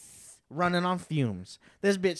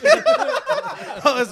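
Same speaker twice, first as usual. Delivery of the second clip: laughing; 10 dB louder than usual. Real, not acted. Laughs at friend's joke.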